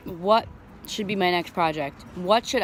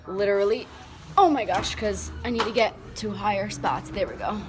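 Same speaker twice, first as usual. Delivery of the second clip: usual speaking voice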